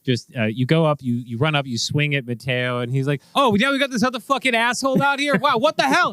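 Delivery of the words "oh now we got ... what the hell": higher, startled cop grumbling at a newcomer; annoyed cop voice, high and a bit loud; surprised cop. Acted. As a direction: play the cop caught off guard, pitched way up.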